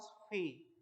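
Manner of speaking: "फी" with an airy f-like onset; "फी" with a puff of air at the start; foreigners' P. Shown as a demonstration. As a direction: say P as "फी", not "पी". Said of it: "they pronounce".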